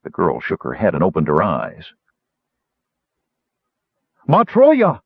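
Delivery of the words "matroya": high, loud outcry; startled cry. A narrator's reading. Captive girl seeing her captors, startled high cry. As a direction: cry out on sight of danger. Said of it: "she cried upon seeing her captors".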